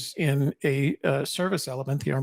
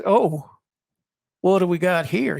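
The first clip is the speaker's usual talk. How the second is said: Southern sergeant; 3 semitones higher, 5 dB louder than usual. Retold or imitated. Drill sergeant taunting recruit at the back, loud Southern accent. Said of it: "He had, he had a Southern accent".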